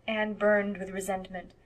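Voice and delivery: usual speaking voice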